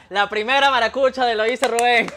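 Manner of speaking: laughing loudly through the words; delighted laughing announcement. Real, not acted. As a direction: burst out laughing as you say it. Host laughing while announcing guest.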